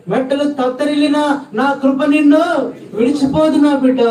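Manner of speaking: high, loud preacher's cry to the crowd; fiery preaching. Acted. Preacher urging the congregation, in a high, loud cry. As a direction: preach it with rising fervour. Climax of sermon.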